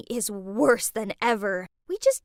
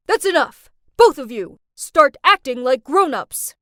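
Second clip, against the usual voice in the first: much louder stern shout; dad shouting; 9 dB louder than usual. Retold or imitated. Dad slamming the table to end the fight, loud stern shout. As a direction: slam it down and stop the fight.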